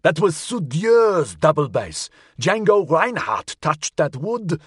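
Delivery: high, slow, anguished protest; shouted outrage. A narrator's reading. Custine mourning a famous double bass to the inspector, shouting. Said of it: "Custine shouted at the inspector".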